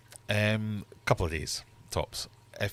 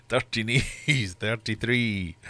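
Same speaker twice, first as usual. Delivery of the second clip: bingo caller; 5 dB louder, slower than usual. Acted. Bingo rhyme call.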